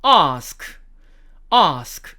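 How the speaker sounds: British "ask" with long open "ah"; British long-a "ask", said twice; British accent. Shown as a demonstration. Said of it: "영국식 발음으로는".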